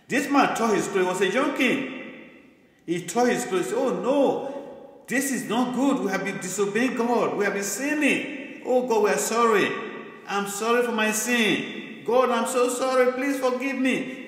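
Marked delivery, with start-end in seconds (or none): 3.7-14.2 high-pitched, acted plea for forgiveness; acted distress, pitched well above usual talk; pleading repentance. Young king confessing sin to God, acted tearful pleading. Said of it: "He was actually crying".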